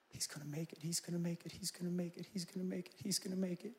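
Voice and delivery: very soft, murmured repetition; hushed repeated prayer. Retold or imitated. Parents praying daily for unborn son, barely audible. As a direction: say it under your breath like a mantra.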